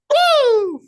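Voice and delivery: high, loud, long whoop; imitated war cry. Colleague mimics students still whooping as the bus pulls up, high and loud. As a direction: keep the group whoop going. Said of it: "we're all still going".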